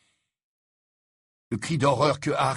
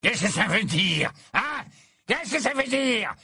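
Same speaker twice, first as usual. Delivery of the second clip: 1 sudden violent outburst; 11 semitones higher than usual. A narrator's reading. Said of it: "s'écria le mange-mort soudain violent".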